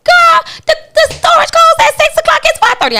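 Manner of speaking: friend's pitch jumps high in disbelief; high mock protest. Quoting the friend who runs her events, high incredulous protest.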